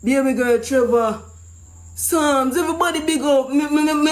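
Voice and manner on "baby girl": ordinary delivery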